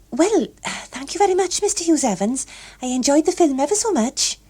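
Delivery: put-on high, polite girl's voice; polite young woman. A narrator's reading. Gloria politely thanking her escort, high voice. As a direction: thank him brightly.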